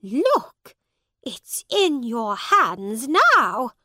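loud, slow, pointing out proof; woman showing the bag in his hands; triumphant accusing. A narrator's reading. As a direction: drive the point home.

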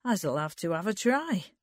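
plain reading voice

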